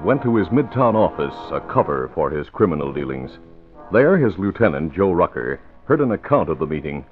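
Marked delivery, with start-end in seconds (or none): none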